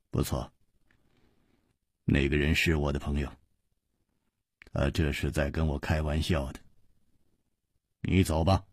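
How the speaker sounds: low, soft, composed reassurance; 楚留香平静低声; calm and unruffled. A narrator's reading. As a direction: let him off gently, unbothered. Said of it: "完全不动声色，只是笑了笑".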